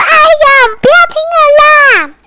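very high, loud whining; whiny child. Acted. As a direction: whine like an embarrassed little boy. Boy embarrassed in story.

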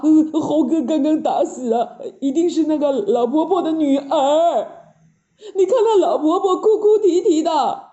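whiny pig-man with grunts; flustered, moaning alarm; Pigsy, panicking. Acted. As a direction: fret to the master, grunting between phrases.

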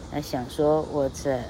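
plain reading voice

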